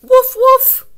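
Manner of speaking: very high, loud yappy woof; puppy bark. Acted. Teacher barking as Biscuit the puppy, high and loud. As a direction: yip like a tiny puppy.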